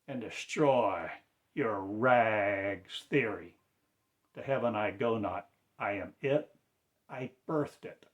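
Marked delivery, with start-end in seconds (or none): 0.1-3.5 "destroy" drawn out slowly, loud and falling; slow the repeat right down; slow emphatic repeat of "destroy". Real, not acted. Repeated call, slowed down.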